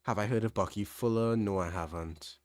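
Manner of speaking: ordinary delivery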